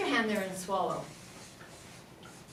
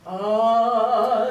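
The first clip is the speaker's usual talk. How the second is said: louder chesty oh; low register oh; 7 dB louder than usual. Shown as a demonstration. Teacher showing the low register she lacked, chesty oh. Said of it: "I had none of this".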